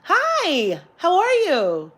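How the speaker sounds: high, loud, sing-out hello; imitated chirpy shop greeting; very pleasant greeting. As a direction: greet like an eager, polite clerk. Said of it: "in a very pleasant way".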